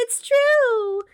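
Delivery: high, loud insistence; insisting. A narrator's reading. Tabby insisting to the laughing man. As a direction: insist hard, desperate. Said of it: "Tabby insisted".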